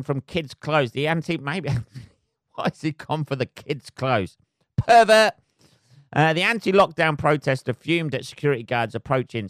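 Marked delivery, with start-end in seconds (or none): 4.8-5.3 mock shout. Real, not acted. Jokey insult shouted out.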